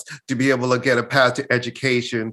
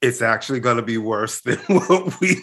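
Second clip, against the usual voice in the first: laughing; 3 semitones higher than usual. Retold or imitated. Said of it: "I said".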